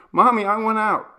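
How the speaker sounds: put-on a small child begging to get out. A narrator's reading. Little daughter whining to her mother, high and loud. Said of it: "said Sarah".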